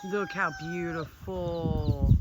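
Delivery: low, very slow admiration; tender awe. Real, not acted. Visitor admires the farm animals, tender awe.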